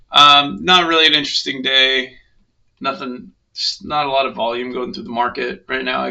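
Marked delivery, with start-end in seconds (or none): none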